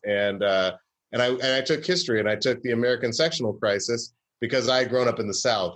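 ordinary talk, nothing marked

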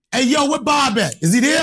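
high, loud street swagger; acting cocky. Caller faking confidence to receptionist, cocky and loud. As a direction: barge in like you own the place. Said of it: "I'm gonna act like cocky".

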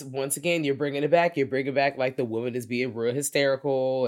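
ordinary delivery

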